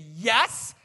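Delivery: loud, eager mock-kid answer; son's emphatic yes. Son answers dad's offer of grace, loud and emphatic. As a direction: blurt it out like a keen child.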